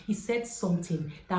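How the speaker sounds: ordinary talk, nothing marked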